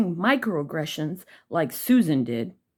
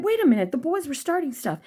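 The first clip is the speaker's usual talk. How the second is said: put-on kid protesting; 9 semitones higher than usual. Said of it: "I said".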